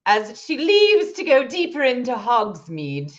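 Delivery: high, slow, hammed-up British accent; bad British accent. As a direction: narrate in a wobbly mock-British accent. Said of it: "get my British accent down eventually".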